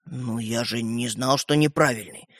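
slow, soft, low excuse; sheepish. Acted. Стёпа excusing himself to the brothers, sheepish. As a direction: mumble an apology, dragging it out.

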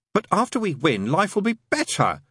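high-pitched, mild croak. A narrator's reading. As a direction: protest with a slight croak. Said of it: "croaked the angel".

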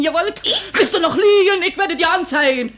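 high, loud, crying shout; furious threat. Acted. Frau John screaming she will report the maid.

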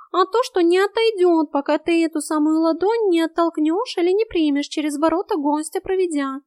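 high-pitched cat voice; high, insistent explanation; urgent cat. A narrator's reading. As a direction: lay out the rules as a cat.

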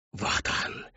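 hissed whispered command; whispered order. Acted. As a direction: whisper it sharply. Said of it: "шёпотом приказала она".

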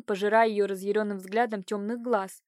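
usual speaking voice

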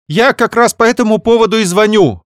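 high, loud blurt from the sister. Acted. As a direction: blurt it out fast. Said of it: "выпалила она". (выпалила, высоко и громко)

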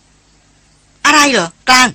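high, loud shouted question; called-out question. A narrator's reading. Chettha to Klang, a short distance behind, called-out question. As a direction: call it out across a short distance. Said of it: "เสียงเชษฐาร้องถามมา".

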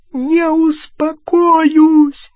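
very high, loud, drawn-out screech; shrieking. A narrator's reading. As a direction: shriek the refusal with every syllable stretched. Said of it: "завизжала она".

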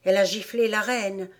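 understated sorry rabbit voice; sorry confession. A narrator's reading. Rabbit confides in Alice, mildly sorrowful. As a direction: confess it regretfully. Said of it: "avoua le lapin d'un air désolé".

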